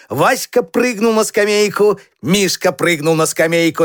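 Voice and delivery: ordinary delivery, nothing marked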